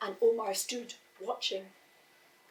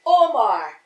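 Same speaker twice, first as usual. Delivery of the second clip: calling out; 14 dB louder than usual. A narrator's reading. Father calling his son on the beach, loud. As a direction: call the name loudly.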